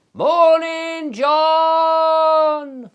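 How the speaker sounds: high, loud call through a megaphone; loud hailer call. Pretend. Neighbour calling across to John through a loudhailer. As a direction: bellow it across the field like a loudhailer. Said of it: "with a loud hailer going".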